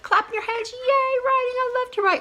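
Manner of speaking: high, squealing cheer for writing; excited student. Retold or imitated. Teacher voicing an eager student, excited cheer. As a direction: gush like a thrilled kid. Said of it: "some about you out there that are just".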